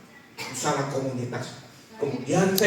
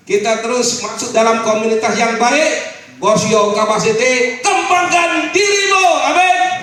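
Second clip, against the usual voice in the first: loud, high rousing preacher cry; full-force sermon climax; preaching at full force; 7 semitones higher, 12 dB louder than usual. Real, not acted. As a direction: fire up the congregation. Rousing altar call.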